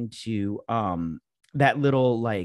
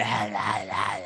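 low mock zombie wail; low mock zombie moan; 5 semitones lower, slower than usual. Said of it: "this woman".

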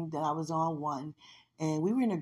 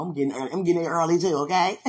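eager boasting; 8 dB louder, much faster than usual. Retold or imitated. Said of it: "some people are like".

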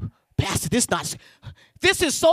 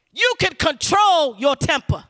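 full-force preaching. Real, not acted. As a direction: drive it home to the congregation.

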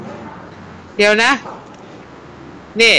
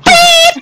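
high whistle blast; 6 dB louder, much slower than usual. Acted. Whistle ending the round.

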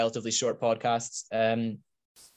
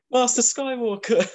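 very high, louder squeaky alien voice; high squeaky Star Wars voice; 12 semitones higher, 5 dB louder than usual. Retold or imitated. Imitating the friend from the video, very high and squeaky. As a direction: squeal it like a little droid. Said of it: "this isn't Beat Saber".

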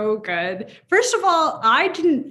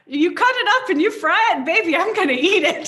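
high, laughing declaration; 7 semitones higher, 5 dB louder than usual. Real, not acted. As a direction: say it through real laughter, pitched up. Laughing through fried potato declaration.

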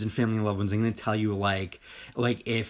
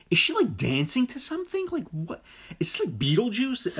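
retold bewilderment; 12 semitones higher than usual. Replaying own bewildered first reaction.

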